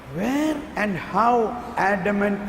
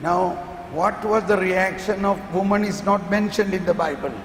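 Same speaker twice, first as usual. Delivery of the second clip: ordinary talk, nothing marked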